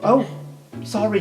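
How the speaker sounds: normal talking voice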